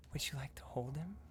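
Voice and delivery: hushed unvoiced whisper, dropping away; whispering ghost maid. Ghost maid hushing the player by the crib. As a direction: shush them like a ghostly nanny.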